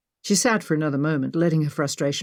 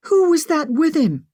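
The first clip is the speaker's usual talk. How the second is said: high, loud, shaken question; distressed demand to know; dismayed; 11 semitones higher, 5 dB louder than usual. A narrator's reading. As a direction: ask in alarm, pitch jumping up. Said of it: "Maggie asked, dismayed".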